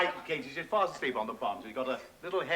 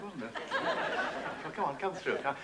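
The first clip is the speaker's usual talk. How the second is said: ordinary talk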